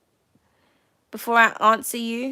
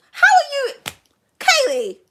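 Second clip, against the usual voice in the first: much higher, louder squealed greeting; excited squeal; 13 semitones higher, 4 dB louder than usual. Real, not acted. To viewer Kaylee, a high excited louder squeal. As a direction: squeal the question with delight.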